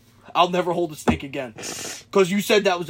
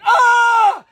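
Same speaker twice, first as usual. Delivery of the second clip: loud high mock scream; retold shriek of shock; panicked scream; 8 dB louder than usual. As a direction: scream it out as the storyteller. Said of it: "he's freaking out".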